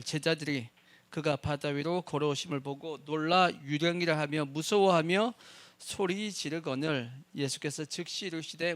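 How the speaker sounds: scripture read out loud and forceful; preaching read. Acted. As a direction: boom the verse to the congregation. Reads scripture at full force.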